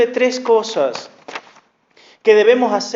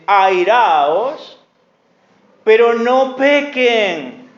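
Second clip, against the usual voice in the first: loud, slow, emphatic Bible reading; thundering scripture reading; preaching scripture; 3 semitones higher, 4 dB louder than usual. Acted. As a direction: proclaim each verse to the church. Reads scripture with preaching force.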